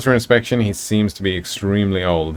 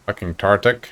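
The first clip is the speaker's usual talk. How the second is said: usual speaking voice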